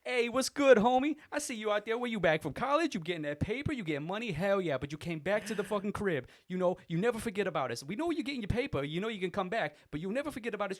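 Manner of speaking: high, loud gushing buddy voice; shower him with praise; Corey hyping up the returning clerk; effusive regular. Retold or imitated. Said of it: "screaming at me".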